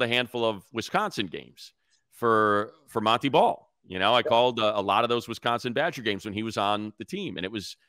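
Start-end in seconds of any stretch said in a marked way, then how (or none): none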